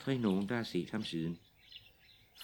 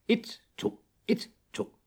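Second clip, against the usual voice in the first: marching count. Acted. Soldier marching.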